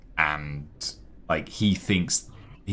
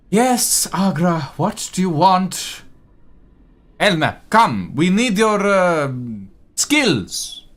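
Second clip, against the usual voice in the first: much higher and louder, exasperated; a put-upon clerk fed up with demands; an overworked elf snapping back at the councilman; fussy elf scribe; 11 semitones higher, 5 dB louder than usual. Acted. Elf scribe, annoyed, voiced by GM.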